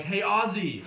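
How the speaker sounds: high, loud party greeting; retold hailing. Partygoers greeting the token Aussie, merry hailing. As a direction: holler the nickname with a laugh.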